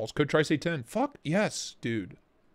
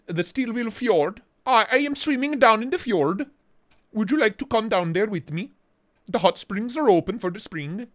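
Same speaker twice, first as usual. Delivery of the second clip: higher-pitched put-on Norwegian accent; 6 semitones higher than usual. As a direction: play up a sing-song Norwegian accent. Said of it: "Kathleen says your accent".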